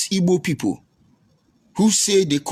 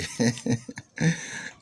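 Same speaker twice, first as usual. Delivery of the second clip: mocking chuckle; 2 semitones lower, 8 dB quieter than usual. Real, not acted. Amused at a heckler's insult, mocking chuckle. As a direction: chuckle at the critic's jibe. Scoffing at the critic's uncompleted building.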